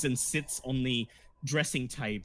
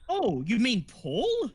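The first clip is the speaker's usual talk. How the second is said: actress surprised; 6 semitones higher, 4 dB louder, slower than usual. Acted. Actress recognizes the name.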